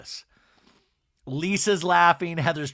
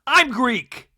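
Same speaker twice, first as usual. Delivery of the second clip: loud, higher mock confrontation; Angie impression; 6 semitones higher, 7 dB louder, slower than usual. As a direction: call her out at raised volume. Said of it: "Angie does her Angie move".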